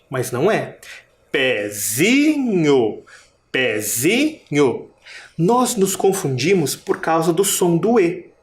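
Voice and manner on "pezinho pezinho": slow Pezinho with a long, rising ZI; stress on ZI. Shown as a demonstration. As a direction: stretch and lift the ZI in pezinho. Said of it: "a sílaba mais forte é o zi". (Pezinho com o ZI forte e bem alongado)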